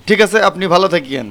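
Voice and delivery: ordinary delivery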